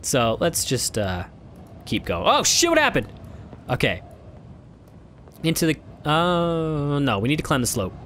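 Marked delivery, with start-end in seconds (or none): 2.3-3.1 startled high exclamation; surprised. Real, not acted. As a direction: caught off guard. Sudden game event.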